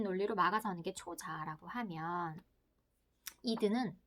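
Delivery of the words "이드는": ordinary delivery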